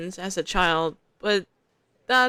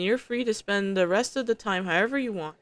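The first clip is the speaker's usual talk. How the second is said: usual speaking voice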